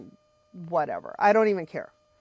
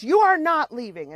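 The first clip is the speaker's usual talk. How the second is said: sharp high yell, much louder; mother-in-law hollers back the refusal; hollering; 8 semitones higher, 5 dB louder than usual. Retold or imitated. Retold yell at the son-in-law, loud and high. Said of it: "and just hollers at me".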